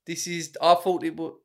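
high, slow mock Nigerian woman; put-on Nigerian woman, drawn-out and disappointed. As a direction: grumble slowly as an improvised Nigerian woman. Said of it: "A woman from Nigeria".

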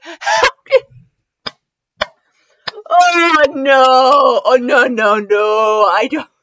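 wailing "oh no" through real laughter; laughing dismay. Real, not acted. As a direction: moan helplessly while cracking up. Breaks down laughing at the story.

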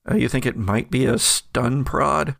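normal talking voice

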